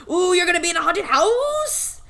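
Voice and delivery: excited. Real, not acted. Excited reaction to scaring job.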